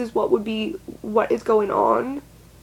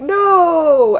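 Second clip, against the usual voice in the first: alarmed shout; 5 semitones higher, 7 dB louder than usual. Retold or imitated. Staff stopping her.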